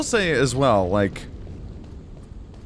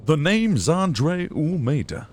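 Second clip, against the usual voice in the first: slow put-on voice, pitched up, swooping; impression attempt; 5 semitones higher, slower than usual. Streamer attempting a game character impression, slow put-on voice. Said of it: "really not a good imp".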